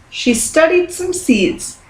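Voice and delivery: plain reading voice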